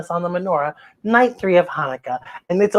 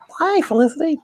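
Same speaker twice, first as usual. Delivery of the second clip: usual speaking voice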